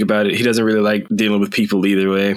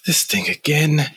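weary sigh; 6 semitones higher, slower than usual. Acted. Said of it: "Fate sighs".

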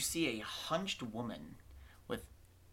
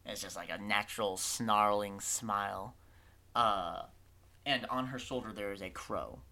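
ordinary talk

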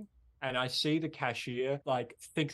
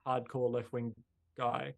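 ordinary talk

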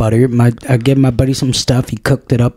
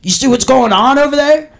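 mock outraged poster; 8 semitones higher than usual. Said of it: "'Cause it's like".

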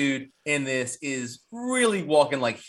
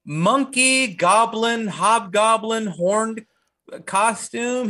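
excited listing; 8 semitones higher, 5 dB louder, slower than usual. Real, not acted.

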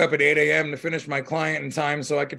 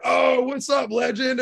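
shouted greeting; 9 semitones higher, 3 dB louder, slower than usual. Retold or imitated.

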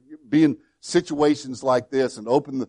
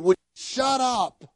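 retold shout; 8 semitones higher, slower than usual. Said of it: "They had just finished saying".

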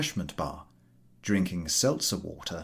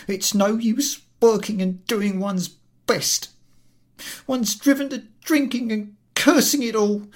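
loud, high self-pitying lament; exhausted complaint; 12 semitones higher, 6 dB louder than usual. Acted. Collector alone in his compartment, complaining. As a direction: grumble to himself in despair. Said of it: "and complains".

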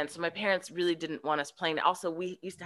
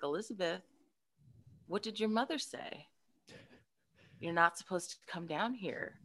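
hushed ghostly warning; 9 dB quieter than usual. Retold or imitated. Ghost lady quietly warning the girl on the basement steps. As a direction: quietly warn the child off.

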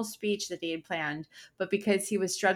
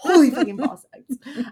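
higher, louder, laughing; laughing repeat; 6 semitones higher, 7 dB louder than usual. Real, not acted. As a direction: savour the phrase through laughter.

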